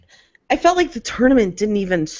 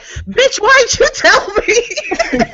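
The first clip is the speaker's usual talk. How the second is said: much higher, slow, laughing; mock outrage; much slower than usual. Friend imitating the host on the phone, high mock outrage. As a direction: drag it out high in fake shock. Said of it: "Reels is like".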